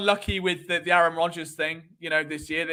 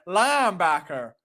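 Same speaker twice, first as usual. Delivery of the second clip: slow, drawn-out Southern drawl; broad put-on Southern linebacker; Southern accent; slower than usual. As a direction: relish a fake Southern accent. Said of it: "do it with a southern ac".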